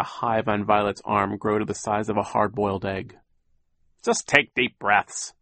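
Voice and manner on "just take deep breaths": slow, sly villain voice; drawn-out, falsely soothing villain; villain Captain Sham. A narrator's reading. Sham brushing off the sick children, slow sly villain. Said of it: "Captain Sham said".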